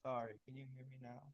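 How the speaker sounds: very faint voice, hard to hear; barely audible. Real, not acted. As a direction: speak so softly it almost vanishes. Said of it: "Yeah, but it's still very low".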